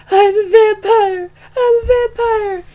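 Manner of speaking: high, loud manic shouting of the line; cracking, near-crying mock scream; retold frantic yell. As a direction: yell it like a man losing his mind. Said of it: "and he's like".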